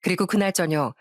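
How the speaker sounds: ordinary delivery, nothing marked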